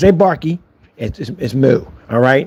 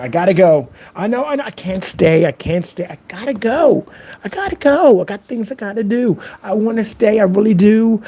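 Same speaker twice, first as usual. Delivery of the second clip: higher-pitched fake pleading to leave; mock-reluctant goodbye; 6 semitones higher than usual. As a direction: act sorry to be going.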